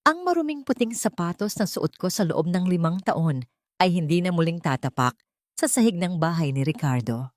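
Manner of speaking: ordinary delivery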